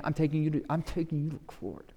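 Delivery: muttered threat with the jaw set; through his teeth. Acted. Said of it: "I'm sure he did it through his teeth".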